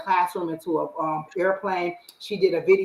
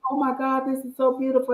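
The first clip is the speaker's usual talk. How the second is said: higher-pitched, moved to tears; mock-weepy awe, pitched up; retold tearful gushing; 7 semitones higher than usual. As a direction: gush as if lightly crying, imitating yourself. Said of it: "I'm reading it and just crying".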